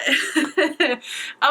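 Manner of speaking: wet laugh through tears; teary laugh. Real, not acted. Laughing to viewers after crying.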